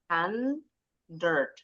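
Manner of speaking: HUNDRED misread like pupils, as "han-derd"; salah ucap murid. Shown as a demonstration. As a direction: imitate the pupils' wrong HUNDRED, vowel before r. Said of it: "bacanya hun-, eh, salah". (HUNDRED ditiru keliru "han-derd")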